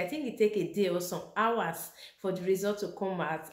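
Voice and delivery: usual speaking voice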